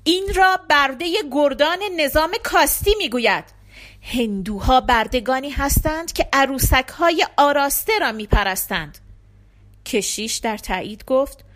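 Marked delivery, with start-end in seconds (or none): 0.0-9.0 louder, higher-pitched indignation; heated imam hurling an accusation; angry. A narrator's reading. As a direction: denounce the rival faith angrily. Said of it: "امام با خشم گفت".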